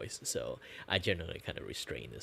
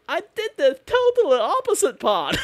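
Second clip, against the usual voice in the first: much higher and louder, youthful; 14 dB louder than usual. Real, not acted. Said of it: "I pitch high my voice to sound more young".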